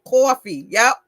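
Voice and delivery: New York "Coffee", with a rounded "aw" vowel; New York accent on "coffee". Shown as a demonstration. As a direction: say "Coffee" as "caw-fee" like a New Yorker. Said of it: "No, that's a New York".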